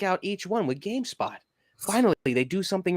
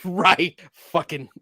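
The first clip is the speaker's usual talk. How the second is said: ordinary talk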